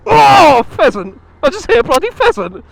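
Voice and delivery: high, loud real shock; shocked shout. Real, not acted. Rider just struck a pheasant on the road, shocked high shout. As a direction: yelp as a bird hits the bike.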